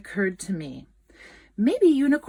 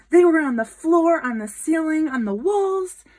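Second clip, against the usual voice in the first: higher, louder, building excitement; excited listing; 5 semitones higher, 6 dB louder than usual. A narrator's reading. Narrator marvelling at scribbles everywhere, excited. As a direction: pile up each place with delight.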